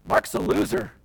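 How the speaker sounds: hammed-up loud scorn; mock disgusted complaint. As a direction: sneer at a hopeless follower, overplayed. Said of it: "walk off and say".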